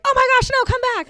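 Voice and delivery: high, loud panicked yell to the dog; frantic high call after an escaping dog; panicked recall. Retold or imitated. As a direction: shriek at a runaway dog. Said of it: "we're like".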